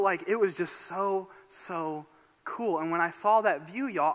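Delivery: ordinary delivery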